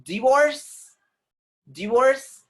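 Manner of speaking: divorce as "dih-VORCE", high and loud; short i in divorce, rising; British pronunciation. Shown as a demonstration.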